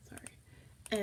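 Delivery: hushed apology aside; whispered. Real, not acted. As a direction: whisper a quick sorry. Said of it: "unvoiced aside".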